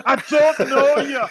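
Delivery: high, put-on voice through a laugh; hammed-up show character. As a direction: squeak out the line, laughing. Acted quote of show character.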